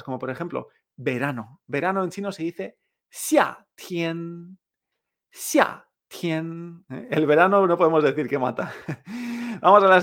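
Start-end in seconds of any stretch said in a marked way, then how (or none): 3.1-6.8 slow "xia" falling, "tian" held even; falling then level tones. Shown as a demonstration. Teacher modelling summer, "xia" falling and "tian" level. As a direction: drop "xia", then hold "tian" on one note. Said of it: "Esta entonación también la vemos".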